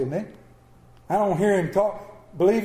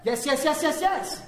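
rapid-fire, higher repeats; mock defiant child; 8 semitones higher than usual. Granddaughter answering grandmother back, rapid mimicked insistence.

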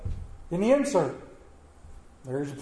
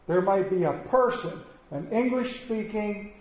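ordinary talk

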